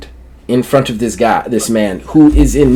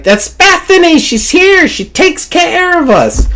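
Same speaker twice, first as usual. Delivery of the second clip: put-on sweet old lady. Old Rebecca greeting Bethany in a high granny voice. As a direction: squeak it like a cheery granny. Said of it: "Rebecca's like".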